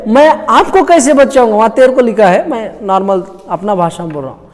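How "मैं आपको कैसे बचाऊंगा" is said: loud, higher mock king; helpless king. Preacher voices the king answering the woman, mock-helpless protest. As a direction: protest lightly in the king's role. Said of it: "ये राजा क्या बोल रहा है".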